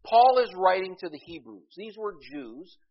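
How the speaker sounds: loud, high preaching; preaching at full force. Acted. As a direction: drive the point home to the church.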